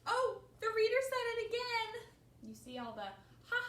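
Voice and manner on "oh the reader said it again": high, loud, amazed glee; tickled delight. A narrator's reading. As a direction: exclaim it as the funniest thing ever. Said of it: "They think it is so funny".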